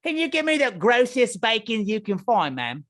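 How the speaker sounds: high, hammed-up British order; mock British accent. Ordering raw bacon in a hammed-up British accent. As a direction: play a fussy Brit ordering breakfast.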